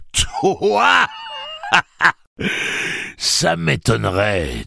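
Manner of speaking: high, loud, mocking laughter; bursting out laughing. A narrator's reading. Tic-Tac scorning Gâcheur's threat, roaring with laughter. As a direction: scoff loudly through a burst of laughter. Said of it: "dit l'homme Tic-Tac dans un éclat de rire".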